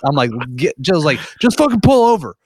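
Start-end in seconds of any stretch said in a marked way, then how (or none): 1.4-2.4 high-pitched, exasperated mock yell; acted-out panicky anger; retold pissed-off outburst. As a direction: re-enact losing it, pitch way up. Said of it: "I'm getting pissed".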